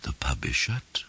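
hushed whispered warning; secretive whisper. A narrator's reading. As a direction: whisper it so nobody outside hears. Hushed warning behind locked doors.

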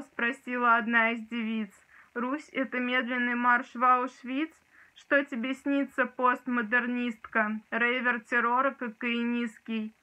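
reciting a poem with dramatic force; dramatic poetry declamation. Shown as a demonstration. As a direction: declaim it like on stage. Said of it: "сейчас тоже его прочитаю".

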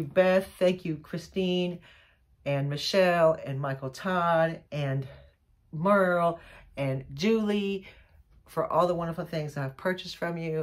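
ordinary delivery

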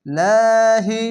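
light-lam recitation of লাহি; thin lam. Shown as a demonstration. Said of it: "পাতলা করে পড়লাম".

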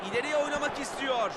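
ordinary talk

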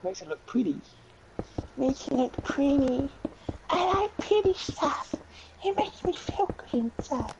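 very high, playful character voice; high-pitched mock-innocent delight; put-on silly voice. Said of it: "in that sort of voice".

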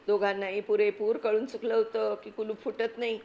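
usual speaking voice

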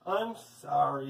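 low, slow, softened apology; sheepish apology. A narrator's reading. Paul lowers his head to Leslie, sheepishly sorry. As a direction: hang back, drawing out the words. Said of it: "Paul lowered his head".

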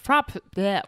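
stuck on "prop-", spluttering out a "bleh"; tongue-tied. Real, not acted. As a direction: botch "prop-", then blurt "bleh". Tongue-tied, gives up on word.

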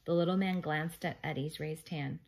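ordinary delivery

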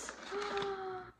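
high, drawn-out gasp; long excited gasp; delighted gasp. Real, not acted. Delighted by the unboxing.

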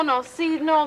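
ordinary talk, nothing marked

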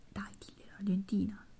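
urgent soft whisper. Acted. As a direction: push her on in a whisper. Friends nudging her aside. (sussurro insistente)

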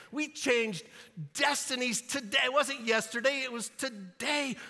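hammered-up indignant reminder; exasperated rant. Acted. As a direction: build in pitch as if they have forgotten everything. Imagined exasperated Jesus scolding disciples.

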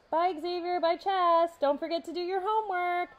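high, loud shout across the hall; called back loudly. A narrator's reading. Courtney shouting after the departing boys, high and loud. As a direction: call after them as they run. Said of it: "Courtney screamed back at them".

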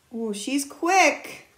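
ordinary delivery

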